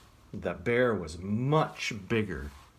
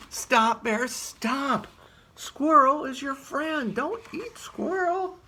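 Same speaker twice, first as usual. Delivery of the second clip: high, louder cry of stop; high pleading shout; 5 dB louder than usual. Acted. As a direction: beg the bear to stop. Pleading bear not to eat.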